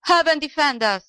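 high-pitched, outraged cry; old man's mild acted scream. A narrator's reading. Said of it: "screamed the old man".

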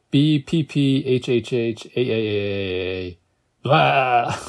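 drawn-out letters breaking into a blare; spelled-out horn blare. A narrator's reading. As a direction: sound out the horn noise, stretching the letters. Sounding out spelled horn noise.

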